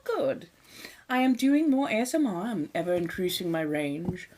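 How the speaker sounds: ordinary talk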